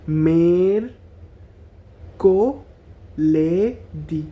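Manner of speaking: very slow, each syllable apart; মেরকোলেদি broken into slow separate syllables. Shown as a demonstration. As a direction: stretch মেরকোলেদি into mer-ko-le-di. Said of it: "syllable ভাঙা ভাঙা পড়লে তাহলে এটা সহজ হবে".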